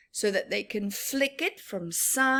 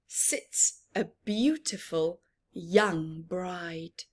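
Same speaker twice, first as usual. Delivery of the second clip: slow storybook verse ending in a whisper; playful picture book reading; reading a rhyme aloud; 3 dB louder, slower than usual. Acted. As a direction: build up, then hush at the bride. Reading rhyming verse to children.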